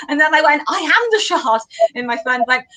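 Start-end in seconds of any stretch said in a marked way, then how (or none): 0.7-1.6 higher-pitched, playful declaration; lightly hammed-up drunken announcement; mock proclamation. Retold tipsy chanting with friend.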